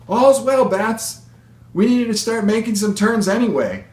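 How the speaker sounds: high, loud call over the engine; shouted encouragement. Acted. Pilot urging nervous passenger to take stick, high cheerful shout. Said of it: "Miss E's shouts of encouragement".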